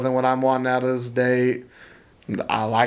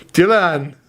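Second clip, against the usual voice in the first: hammed-up Swedish accent; a playful Swedish accent; 7 semitones higher, 5 dB louder, slower than usual. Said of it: "I'm gonna do my best Swedish accent".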